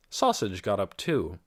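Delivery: ordinary delivery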